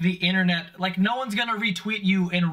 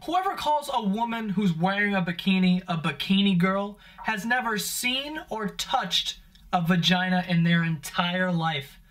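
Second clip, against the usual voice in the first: usual speaking voice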